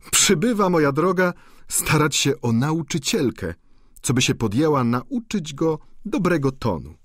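amused viscountess. A narrator's reading. Viscountess laughingly explains Eugene to the duchess, teasing. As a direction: tease the duchess about him.

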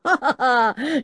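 big booming mock laugh of a hearty professor; hearty hammed-up ha ha ha; great laugh. A narrator's reading. Professor laughing his great laugh at his children. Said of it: "laughed his great laugh".

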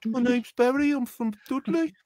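high, hammed-up Birmingham-area accent; Dudley accent impression. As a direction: have a playful go at a Dudley accent. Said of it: "I can do Dudley".

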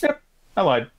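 breezy high confession; a hint of a cheerful boy owning up; lightly voiced Norman. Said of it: "And Norman's like".

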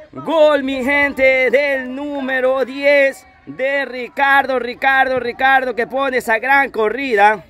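high, slow, savoring the goal; excited commentary. Real, not acted. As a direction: linger on every word of the goal. Celebración tras el gol. (narración alegre, aguda y pausada)

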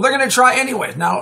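usual speaking voice

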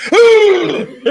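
high, loud mock "ooh"; imitated startled squeal. Storyteller imitates woman seeing the missing leg, startled squeal. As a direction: squeal it, imitating her shock. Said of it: "she's like".

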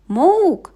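loud high mock bleat; creature call. A narrator's reading. Boy mocking the creature noise, loud. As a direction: mimic the beast boldly.

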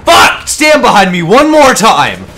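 loud, exasperated gamer outburst; angry shout. Real, not acted. Gamer warning a teammate off standing behind them, angry shout. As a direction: yell it at a teammate in the way.

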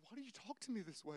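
soft, mock-tearful complaint; imitated crying. Husband imitating his crying wife's complaint, soft and mock-tearful. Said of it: "she would be, like, crying".

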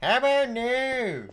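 high, slow mocking echo; mocking imitation. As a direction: parrot it back in a silly high voice. Mimics friend's phrase.

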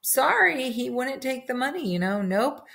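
high, apologetic messenger voice, rushing the excuse; sheepish messengers. Acted. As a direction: messengers tell the king Balaam refused, sheepish and high. Messengers report back to king.

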